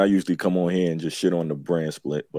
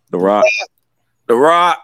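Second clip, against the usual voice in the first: hype announcer call; 4 semitones higher, 10 dB louder than usual. Acted. Hyping The Rock's name.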